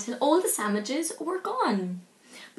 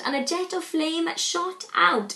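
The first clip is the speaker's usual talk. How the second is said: ordinary delivery, nothing marked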